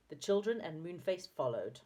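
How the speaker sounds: usual speaking voice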